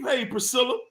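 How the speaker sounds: ordinary delivery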